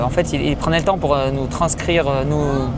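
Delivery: usual speaking voice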